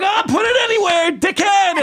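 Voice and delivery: high-pitched mock yell of a bar heckler; heckler shout. Drunk bar-goer heckling the amateur on stage, high mocking shout.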